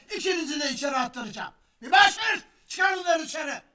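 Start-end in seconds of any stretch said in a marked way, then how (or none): 1.8-3.6 calling out. Acted. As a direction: shout for the bailiff. Judge calling bailiff.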